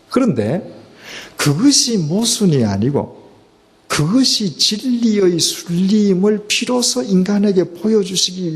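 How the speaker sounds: climbing pulpit intensity; fervent preaching. Acted. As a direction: preach it out with rising fervor. Preaching rises to full force.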